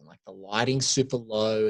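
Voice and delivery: ordinary talk, nothing marked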